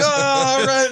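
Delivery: high, loud cheering crowd. Acted. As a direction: cheer like a rowdy bar crowd. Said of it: "There's, like, a cheer".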